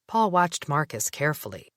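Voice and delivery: plain reading voice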